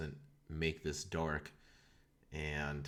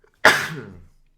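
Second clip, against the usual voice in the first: loud real sneeze; a sudden sneeze into the mic; 4 semitones higher, 21 dB louder than usual. Real, not acted. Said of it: "I have to sneeze".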